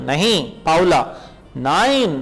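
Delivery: ordinary talk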